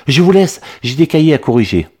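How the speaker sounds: aigu et pressé, on s'échappe vers sa classe; pressé de filer. A narrator's reading. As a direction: une voix plus haute qui file vite. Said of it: "en se sauvant dans sa classe". (voix aiguë et pressée)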